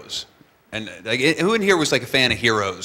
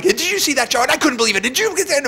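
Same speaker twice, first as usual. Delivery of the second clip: excited disbelief; 14 semitones higher, 3 dB louder than usual. Retold or imitated. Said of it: "And we were like".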